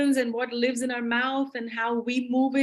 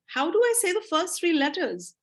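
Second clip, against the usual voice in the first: higher, puzzled questioning; mock bewilderment, pitched up; confused beginner; 6 semitones higher than usual. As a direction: act a learner baffled by spelling. Voicing a confused beginner reader.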